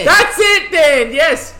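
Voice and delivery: very high, loud delight; real, thrilled shout of recognition; excited outburst. Real, not acted. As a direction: yell it out, they nailed it.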